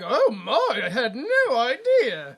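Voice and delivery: high, loud, drawn-out fake shock; mock innocent belle. Assistant feigning shock at his advance, hammed-up innocence. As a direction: ham up a scandalised innocent.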